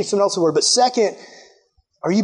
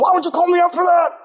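ticked-off retort; 9 semitones higher, faster than usual. Retold or imitated. Preacher voicing a church member caught in sin, indignant and fast. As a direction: snap back at the friend who called you out. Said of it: "You're ticked off".

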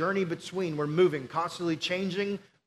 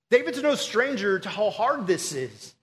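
normal talking voice

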